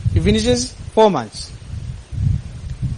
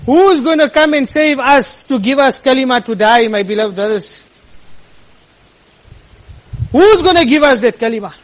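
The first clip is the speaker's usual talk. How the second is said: higher, louder appeal to the brothers; impassioned preaching; 5 semitones higher, 4 dB louder than usual. Real, not acted. Preacher appealing loudly to his beloved brothers. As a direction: cry out the question to the congregation.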